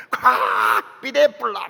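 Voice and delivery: loud, drawn-out mock threat in Gyeongsang dialect. Preacher imitating a brawling Gyeongsang churchgoer, Gyeongsang dialect threat. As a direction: explode on a long first syllable, Gyeongsang style. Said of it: "경상도 사람들이 그게 진짜 싸우는".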